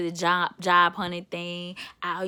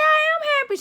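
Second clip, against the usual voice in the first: very high, squeaky mock protest; defensive insistence; 4 dB louder, faster than usual. Acts out her defensive answer.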